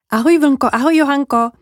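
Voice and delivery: high-pitched little bird's farewell; cheery goodbye. Acted. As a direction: call goodbye to two friends. Jay calls goodbye to friends.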